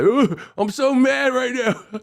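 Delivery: hammed-up tantrum, high and drawn out; high, slow fake-angry whine; mock mad. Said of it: "I don't do this when I really get mad".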